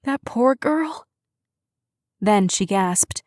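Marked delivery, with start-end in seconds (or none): 0.0-1.0 high and slow, a mild whimper; understated slow tearful sigh of pity. A narrator's reading. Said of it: "Karen whimpered".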